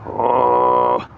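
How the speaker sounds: short guttural throat-sung tone; dag gargara drone. Shown as a demonstration. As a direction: demonstrate the kargyraa voice in a brief burst. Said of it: "dag gargara".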